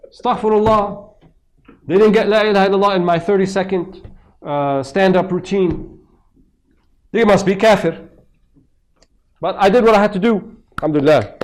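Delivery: loud, high, self-righteous preacher parody; mock zealous preacher. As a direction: ham up a smug street preacher. Mocking a preachy street dawah guy.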